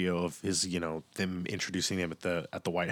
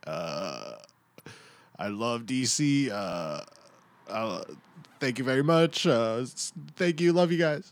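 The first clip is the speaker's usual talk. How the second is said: higher and louder, hesitant broken English; halting player thanks the crowd, climbing higher and louder; imitating Juan Soto; 4 semitones higher, 4 dB louder than usual. Host mimics Soto thanking DC fans, halting and rising. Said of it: "He's just like".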